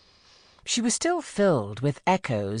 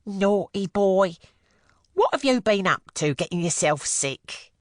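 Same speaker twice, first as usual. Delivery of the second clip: mock scolding; 6 semitones higher than usual. A narrator's reading. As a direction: tease him like a naughty child. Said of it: "went on".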